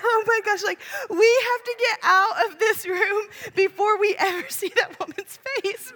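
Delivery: high-pitched, embarrassed urgency; hammed-up parent desperate to flee; mortified panic. Mom urges kids out of the bathroom, mortified panic.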